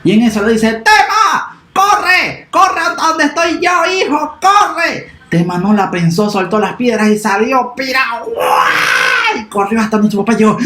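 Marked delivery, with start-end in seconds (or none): 0.7-5.1 grito muy agudo y urgente; llama al hijo desde lejos, apurado; llamada urgente. Acted. Papá llama con urgencia. 8.3-9.4 exclamación muy aguda al salir corriendo; grito de arranque. Acted. Niño sale corriendo.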